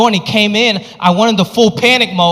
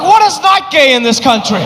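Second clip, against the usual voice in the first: exasperated retort; 9 semitones higher than usual. Retold or imitated. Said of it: "I'm like".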